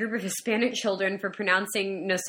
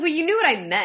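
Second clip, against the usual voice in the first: higher, louder comeback; retold protest; 6 semitones higher, 5 dB louder, faster than usual. Speaker answering a correction from Jeremy, protesting. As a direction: push back at being corrected. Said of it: "And I was like".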